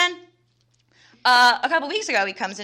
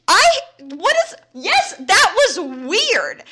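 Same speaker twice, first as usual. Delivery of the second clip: high, loud disbelief, slowing on "that was weird"; stunned and exasperated, drawing it out; 9 semitones higher, 8 dB louder, slower than usual. Retold or imitated. Telling her coworker about the weird interaction, high exasperated disbelief.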